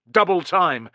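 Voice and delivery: usual speaking voice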